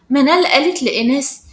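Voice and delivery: ordinary delivery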